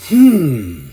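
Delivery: low drawn-out hum of fake profundity; mock thoughtful hum. Mocking a podcaster who hums at every remark, low hum. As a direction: hum like the guest said something deep.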